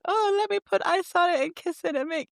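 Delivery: high sweet coddling voice; mock doting mom. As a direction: coo like an overdoting mother. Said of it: "it's not like he's like me where I'm like".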